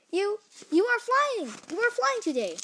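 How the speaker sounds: high-pitched, astonished exclamation; amazed. Acted. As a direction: marvel aloud, voice leaping up. Gerald amazed seeing Piggy fly.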